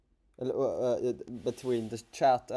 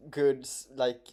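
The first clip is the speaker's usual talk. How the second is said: ordinary talk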